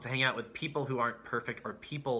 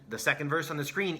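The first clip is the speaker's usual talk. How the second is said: usual speaking voice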